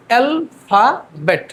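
"Alphabet" very slowly in three separate beats; syllable by syllable. Shown as a demonstration. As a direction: stretch "alphabet" into al, fa, bet. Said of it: "আমরা ভেঙে ভেঙে". ("Alphabet" তিন সিলেবলে ভেঙে ধীরে)

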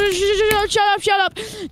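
rapid high-pitched repeats, cutting in; insistent interrupting. Real, not acted. Gamer silencing a friend to finish a joke, insistent interrupting. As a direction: talk over them in a high, insistent stream. Talking over the friend's objections.

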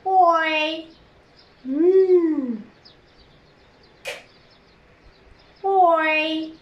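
ك, أوي, مم held apart as long separate sounds; sounded out. Shown as a demonstration. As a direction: sound out k, oy, n slowly with long gaps.

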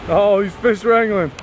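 ordinary talk, nothing marked

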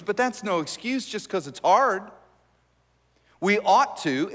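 ordinary delivery

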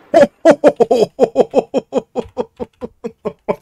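high, fading laughter; long high laugh. Real, not acted. Laughing at gameplay.

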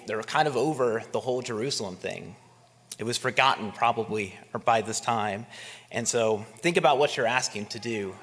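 ordinary talk

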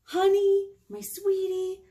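doting parent. Acted. Parent greets child fondly.

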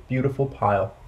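ordinary delivery

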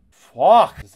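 loud furious curse; angry shout. Real, not acted. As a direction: blurt it out in rage. Dying in game.

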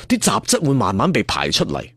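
ordinary delivery

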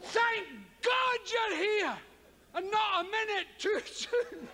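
he's the hero arriving just in time; grand relief. Shown as a demonstration. Comic actor hams up his relief at being rescued. Big theatrical take on the line.

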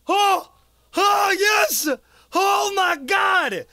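high, loud victory cries; slow, stretched celebratory yell; ecstatic shout. Real, not acted. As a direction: shout in disbelieving triumph. Celebrates reaching first milestone.

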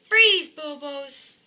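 slow loud stern command; freeze command. Acted. Stopping the bouncing monkeys, slow loud command. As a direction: order the monkeys to stop. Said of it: "Now say".

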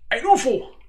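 high excited shriek; shrieking jackpot winner. Real, not acted. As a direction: yell it like you just won big. Spots UFO in game.

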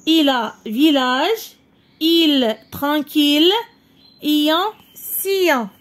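slowly, word by word, stressing "il"; slow model reading. Shown as a demonstration. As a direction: read "il a village, il tranquille" like a teacher. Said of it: "teacher reads word list".

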